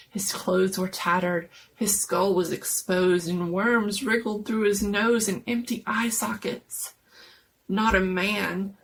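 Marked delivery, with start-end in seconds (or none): none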